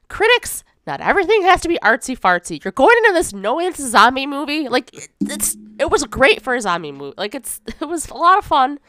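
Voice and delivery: high, loud and riled up; worked up. Real, not acted. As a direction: rant about it with heat. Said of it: "you're getting worked up over".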